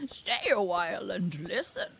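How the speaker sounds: failed Deckard Cain that comes out as a masked villain; slow put-on villain voice with swooping pitch; Bane. Showing stream her secret talent, Bane-like impression. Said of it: "instead sounded like Bane".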